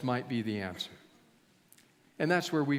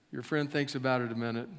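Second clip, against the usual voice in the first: normal talking voice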